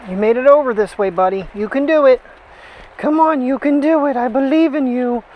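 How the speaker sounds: high, loud coaxing encouragement. Real, not acted. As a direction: cheer the little one on gently. Coaxing dog over obstacle.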